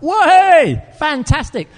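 high, loud whoop of delight; elated cheer. Retold or imitated. Young man hears God promise friends a child, elated cheer. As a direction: celebrate with an acted burst of joy. His delight at God's message.

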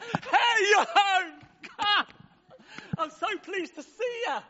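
high, loud, bursting with joy at the homecoming; a father shouting his delight down the road; overjoyed father. Acted. Father running to greet his lost son, high, loud and overjoyed.

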